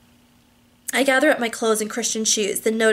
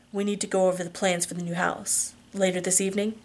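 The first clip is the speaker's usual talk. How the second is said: relaxed husband; 2 semitones lower, 6 dB quieter than usual. Acted. Husband in the bath with wife, softly. Husband figure, low and soft.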